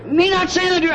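mock-affronted wife, very high pitch; indignant protest. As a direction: imitate her jumping in to object, voice shooting up. Wife's shocked objection acted out.

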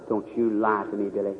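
very low, soft reproach; quiet fatherly warning. Retold or imitated. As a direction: warn the boy under your breath. Father confronts son, retold.

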